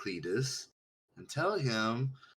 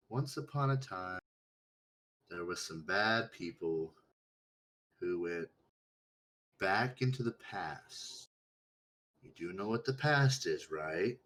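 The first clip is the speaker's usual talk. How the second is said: put-on reading to children; 2 semitones lower than usual. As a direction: tell it like a bedtime story. Storytelling to simple character.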